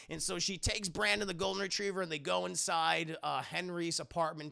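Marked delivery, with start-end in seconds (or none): none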